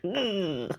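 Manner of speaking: high, long exaggerated "mmm"; drawn-out "mm". Retelling the egg tasting, exaggerated high hum. Imitates noise over the egg.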